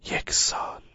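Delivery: hushed whispered aside. A narrator's reading. Narrator whispering an aside to the audience. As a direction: breathe it out to the listener.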